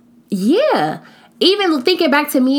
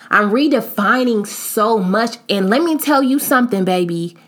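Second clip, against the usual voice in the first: ordinary talk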